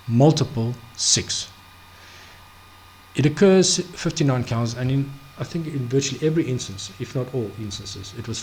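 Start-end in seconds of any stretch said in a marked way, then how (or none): none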